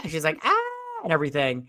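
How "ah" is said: high-pitched imitated scream; mock scream. Retelling woman's startled cry.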